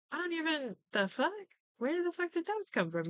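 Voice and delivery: a high, baffled outburst, pitch jumping up; imitating a stunned aunt caught off guard; shocked aunt. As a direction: play the aunt who can't believe they were found. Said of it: "the aunt's just like".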